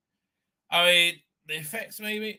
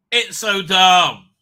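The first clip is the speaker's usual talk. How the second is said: louder and slow, stretched in disgust; 2 semitones higher, 10 dB louder, much slower than usual. Real, not acted. Host ranting to chat about the plot, loud and slow. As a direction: drag out the words, loud and fed up. Repeating it loudly.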